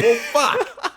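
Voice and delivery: high laughing curse; mock the partner's deflated swear; imitating a resigned curse.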